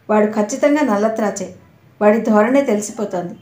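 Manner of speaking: ordinary delivery, nothing marked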